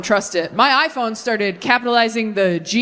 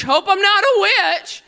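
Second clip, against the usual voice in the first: put-on worried teen; 8 semitones higher, slower than usual. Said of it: "You're like".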